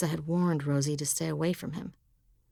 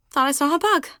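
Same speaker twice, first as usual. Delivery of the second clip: higher, louder fake nonchalance; forced casual; 11 semitones higher, 9 dB louder, faster than usual. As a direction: brush it off brightly. Covering with forced smile.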